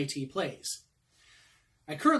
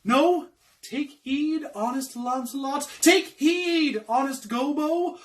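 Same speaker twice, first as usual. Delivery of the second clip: warning conscience; 9 semitones higher, 6 dB louder than usual. Acted. Conscience warns servant not to run, high theatrical pleading. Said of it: "My conscience says to me".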